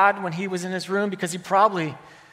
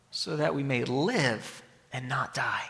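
softer and slower, sinking low; hushed, slowed; 7 semitones lower, 8 dB quieter, slower than usual. Shown as a demonstration. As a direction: let the promise settle quietly and slowly.

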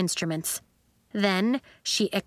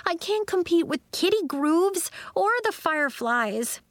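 high-pitched anxious moan; a despairing, high complaint; 9 semitones higher than usual. A narrator's reading. Nori dreads competing with the other acts, moaning. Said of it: "Nori moaned".

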